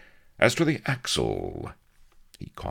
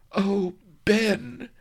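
very high, anguished woman's cry; distressed lover; 4 dB louder than usual. A narrator's reading. Vanity fears for Avery's life, high distressed cry. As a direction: cry out to your beloved.

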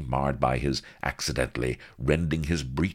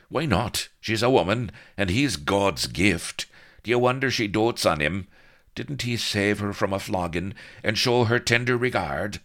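higher, louder rhetorical questions; needling; 7 semitones higher, 5 dB louder than usual. A narrator's reading. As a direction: goad her with mock praise of him. Blood needles jealous Vanity.

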